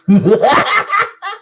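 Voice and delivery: very high, loud clown cackle; creepy clown laugh. Acted. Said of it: "Creepy clown laugh".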